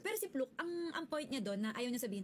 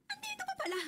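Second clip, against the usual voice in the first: a much higher, cracking cry of surprise; surprised cry; 11 semitones higher than usual. Real, not acted. Telling viewers her friend never left for Qatar, high squealing surprise. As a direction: squeal that she's still here. Surprise that Mars is still here.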